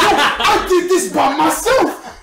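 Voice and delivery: loud, high, drawn-out retold yell; angry outburst. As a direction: yell it in protest at the teacher. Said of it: "I started cursing at the man".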